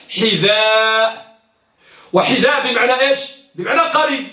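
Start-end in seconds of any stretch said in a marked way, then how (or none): 0.1-1.4 حذاء with the final "aa" held very long; long drawn-out vowel. Shown as a demonstration. Teacher showing the madd in حذاء, long drawn-out vowel. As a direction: stretch the "dhaa" in حذاء. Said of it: "ذال المعجمة ممدودة".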